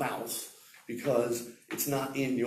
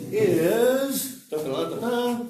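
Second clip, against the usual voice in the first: high, loud "da" fanfare; mock drum roll; 9 semitones higher, 7 dB louder, slower than usual. Teacher announcing the fourth thing to students, mock drum roll. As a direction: build suspense with sung-out da-da-das.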